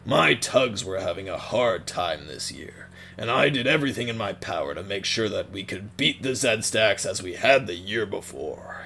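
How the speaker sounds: gruff serious Captain Star. Shown as a demonstration. Captain Star telling of a hard year for his Tugs, gruff and serious. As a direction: speak like a worn captain reporting a bad year. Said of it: "Captain Star should sound something like this".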